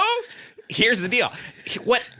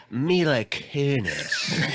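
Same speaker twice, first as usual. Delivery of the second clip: sleazy shock jock; 3 semitones lower, 4 dB quieter, much slower than usual. Acted. Shock jock hottie pick.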